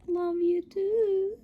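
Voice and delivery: high, slow, sweet dog voice; tiny high-pitched dog answering back; dog voice reply. Pretend. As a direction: answer as the pup, stretched out high. Answers in the dog's voice.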